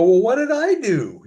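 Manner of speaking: high, loud mock-innocent protest; retold startled what-did-I-do; indignant surprise. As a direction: throw it back, baffled and indignant. Retold protest at being blamed.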